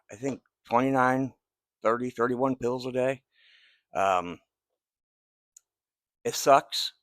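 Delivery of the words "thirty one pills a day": usual speaking voice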